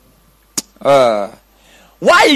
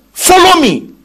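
high, loud summons; sudden high, loud command; commanding call; 10 semitones higher, 5 dB louder, slower than usual. Acted. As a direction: call a follower with full authority. Acts out Jesus commanding somebody.

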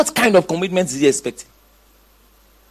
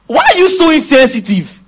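acted indignation; 6 semitones higher, 3 dB louder than usual. Voices an offended hearer of Jesus.

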